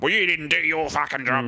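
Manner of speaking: high, sneering mock accusation; mock blame. Crew member blaming the sound guy at final tech, high mock snap. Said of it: "being like".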